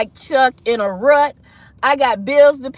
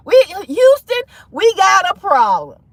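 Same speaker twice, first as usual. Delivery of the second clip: raised, loud comic announcement; mock alarm; 5 semitones higher, 4 dB louder than usual. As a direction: call out the trouble to mission control. Mock announcement of money trouble.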